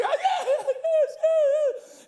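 high, loud hammed-up yeses; overwhelmed mock excitement. Imitating the family ready to see the house, high squealing. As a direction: squeal yes as an overcome family. Tearful family answering the host.

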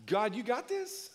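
slow, laughing, doubtful question; doubtful plea. Retold or imitated. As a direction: ask it like faith is slipping. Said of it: "Monday you're like".

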